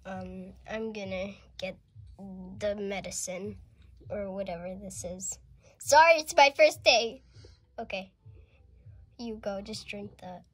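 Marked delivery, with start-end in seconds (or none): 5.8-7.2 flustered apology. Pretend. Flustered pretend dentist apologizes.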